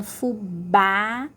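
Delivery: "fubá" slowly, with a wide open á; "fubá" drawn out, stressed open final á; open vowel. Shown as a demonstration. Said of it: "o som é aberto".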